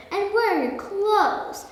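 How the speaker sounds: high, slow, exasperated question; mock-outraged storybook mum; scolding mother. A narrator's reading. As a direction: stretch it out in motherly disbelief.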